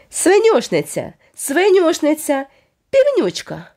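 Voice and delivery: slow, high taunting singsong; mocking jeer. A narrator's reading. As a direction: drag each name out to sting. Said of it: "він укотре загиготів".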